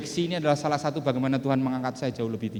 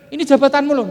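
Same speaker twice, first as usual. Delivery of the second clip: warning boss; 10 semitones higher, 10 dB louder than usual. Retold or imitated. Boss warns about the job.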